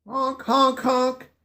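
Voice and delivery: high, nasal-free honking like geese overhead; goose honks. Acted. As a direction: call out like passing geese. Geese calling in the story.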